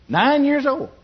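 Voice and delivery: high, emphatic exclamation; exclaimed. Real, not acted. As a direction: make the age sound astonishing. Stresses his young age.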